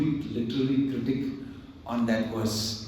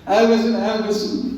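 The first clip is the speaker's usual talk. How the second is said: much higher, louder and fast; eager kid; 10 semitones higher, 10 dB louder, much faster than usual. Retold or imitated. Boy eagerly calling out to the teacher, fast and loud. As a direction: blurt it out like a keen child.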